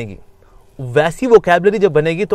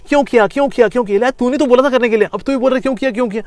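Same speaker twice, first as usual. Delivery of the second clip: higher, accusing nag; mock accusing; 6 semitones higher, faster than usual. Mind and self bickering after the deed, mock blame. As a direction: scold yourself for doing it.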